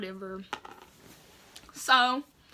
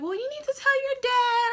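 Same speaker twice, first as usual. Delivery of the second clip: much higher, put-on mother's voice; mom's voice; 12 semitones higher than usual. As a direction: play mom gently insisting. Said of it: "my mom's like".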